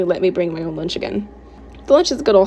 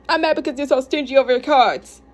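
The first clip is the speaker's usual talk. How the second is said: indignant accusation; 5 semitones higher than usual. Acted. Quarrel, accusing partner of stinginess.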